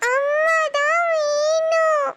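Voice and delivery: put-on a small child sobbing out the word; crying for mother. Little daughter to her father, wailing that she wants mother.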